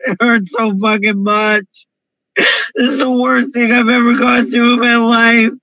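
ordinary talk

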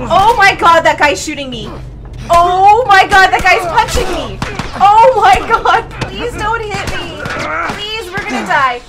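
loud alarmed pleading; panicked cry. Real, not acted. Gamer getting shot and punched, panicked cry.

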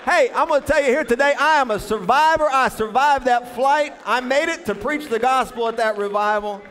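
high, loud preacher's shout; mock-heroic preaching at full force; triumphant testimony. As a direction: declare survival like a revival testimony. Mock testimony of surviving flight.

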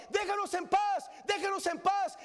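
the preacher voicing the tepid church, shouting; shouted mock plea.

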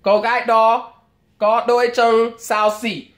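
nói nhanh tiếng Việt tiệm móng; pedicure salon Vietnamese. Retold or imitated. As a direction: rattle it off as one smooth salon remark.

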